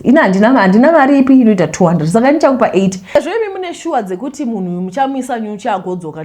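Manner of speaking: ordinary delivery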